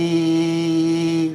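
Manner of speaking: "eh" held long on one steady tone; correct Bugs Bunny tone. Shown as a demonstration. Impressionist teaching the tone, long steady "eh". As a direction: sustain "eh" evenly in the cartoon rabbit's tone. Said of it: "the tone is".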